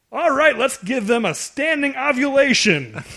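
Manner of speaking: high, slow, drawn-out announcing of a pun; mock jeering heckler. Heckler at the band milking his pun, mock-enthusiastic. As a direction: stretch it out high like a jerk rallying applause. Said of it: "the guy goes".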